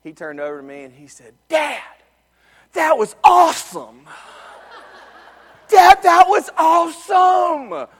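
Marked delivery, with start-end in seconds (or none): 1.5-7.9 put-on thrilled kid. Son thrilled after the ride.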